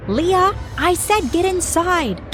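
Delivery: high angry scream, slow. A narrator's reading. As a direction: shriek the command. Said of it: "my mom screamed".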